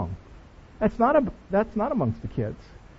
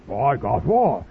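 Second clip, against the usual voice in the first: lower, bloke-ish reply; man voice; 9 semitones lower than usual. Retold or imitated. Mock man voice as a guy answers his buddy. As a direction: answer like one of the guys. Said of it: "And they go".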